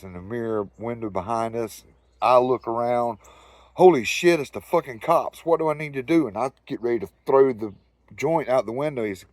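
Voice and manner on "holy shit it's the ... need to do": higher, louder alarmed outburst; stoned passenger panicking about the cops; mock panic. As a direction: blurt it out as the cop lights appear. Panic at police lights.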